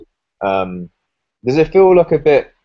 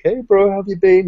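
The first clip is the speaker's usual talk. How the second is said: higher, hammed-up chummy greeting; overfriendly bro; 11 semitones higher, 4 dB louder than usual. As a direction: play the clueless buddy catching up. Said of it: "He's like".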